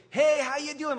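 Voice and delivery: high, loud upbeat hello; cheery greeting. Acted.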